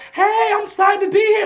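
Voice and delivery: high-pitched, excited shout-out; hyped. Retold or imitated. Singer greets her escort on the carpet, imitated hyped greeting. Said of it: "she was just so hyped".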